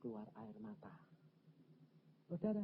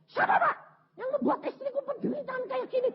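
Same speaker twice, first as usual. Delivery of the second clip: high, loud furious outburst; angry husband; 3 dB louder than usual. Retold or imitated. Husband demanding who hurt his wife, high angry shout. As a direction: shout it like a husband seeing red.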